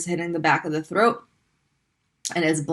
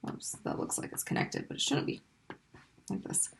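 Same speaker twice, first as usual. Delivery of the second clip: whispered aside; 15 dB quieter than usual. Real, not acted. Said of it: "teacher mutters while writing".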